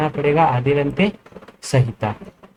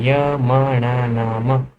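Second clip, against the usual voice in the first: य म न न म very slow and lower; slow nasal demo; 3 semitones lower, much slower than usual. Shown as a demonstration. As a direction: hum each of य म न न म through the nose, slowly. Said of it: "नासिका से उच्चारित". (धीमे, नाक से य म न न म)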